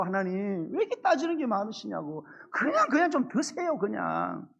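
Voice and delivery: a light mock whine of people haggling with God; grumbling Israelites. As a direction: play the griping worshipper, mildly peeved. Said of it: "이스라엘 백성들은 속상한 거예요". (살짝 투덜거리며 하나님께 따지는 백성 흉내)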